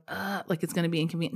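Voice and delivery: usual speaking voice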